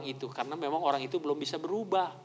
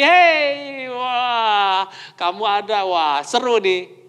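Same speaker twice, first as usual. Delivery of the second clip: higher and louder, a bright delighted hello; cheerful greeting; 6 semitones higher, 6 dB louder, much slower than usual. Acted. Preacher acting out the person who makes everyone happy, delighted. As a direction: greet everyone like you're thrilled they came.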